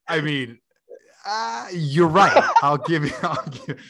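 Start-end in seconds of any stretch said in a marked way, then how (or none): 1.3-3.8 high, slow words squeezed out through laughter; conceding while cracking up; real laugh breaking the words. Real, not acted. Cracking up mid-sentence.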